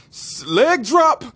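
high, drawn-out mock war cry; hammed-up loud, slow fight yell; shouted move call. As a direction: holler it like a wrestling move. Acted fighter announcing his move.

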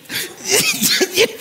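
high helpless laugh; burst of laughter. Real, not acted. Host losing it at the guest. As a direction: let a real laugh burst out.

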